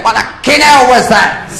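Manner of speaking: high, loud yell of alarm; acted startled husband, shouting; shocked shout. Second groom's shocked reaction.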